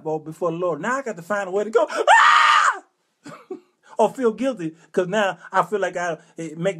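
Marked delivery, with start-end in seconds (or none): 1.9-2.8 high, loud drawn-out scream; mock church scream. Comic forced to scream before the Lord, screaming. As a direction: let out an exaggerated high scream. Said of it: "scream like I never have".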